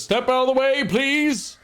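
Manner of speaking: high, loud town constable clearing the way; constable's command. Acted. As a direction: order the crowd aside like a stern lawman. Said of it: "He says".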